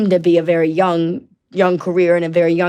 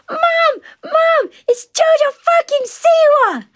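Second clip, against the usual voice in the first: imitated small child calling out, very high and stretched; shouting toddler; 4 dB louder, slower than usual. Toddler calling mom about JoJo, high shrieking shout. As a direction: squeal it slowly like a three-year-old calling a parent. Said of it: "She goes".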